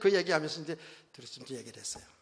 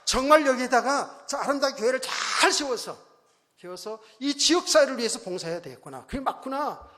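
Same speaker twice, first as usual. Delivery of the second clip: high, loud burst of conviction; resolved believer; 8 semitones higher, 9 dB louder than usual. Acted. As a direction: voice a congregant's fervent resolve, raised and emphatic. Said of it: "여러분 믿음으로".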